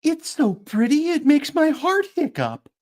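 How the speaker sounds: high, bright sing-song voice; delighted chirrupy sing-song; chirrupy Pepper. Acted. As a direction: gush it out in a chirpy lilt. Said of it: "chirrupy sing-song voice".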